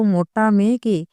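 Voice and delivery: ordinary delivery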